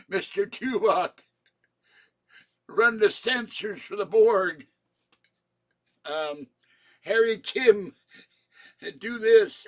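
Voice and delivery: high, loud barked orders; mock captain orders. Mock captain ordering Tuvok and Harry Kim, high and loud. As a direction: play a flustered captain calling crew.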